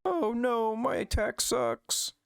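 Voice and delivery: very high, slow cartoon whine; mock whimper. Pokemon complaining that its attacks got weakened, high slow whine. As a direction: drag out the mock despair.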